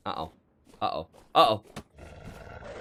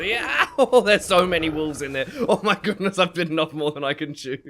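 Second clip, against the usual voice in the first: laughing; 8 semitones higher, 9 dB louder than usual. Real, not acted. Swarmed by wolves, laughing.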